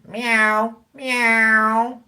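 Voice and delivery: high, drawn-out imitation meow; cat meow. Streamer teases viewer called pussycat, high mock meowing.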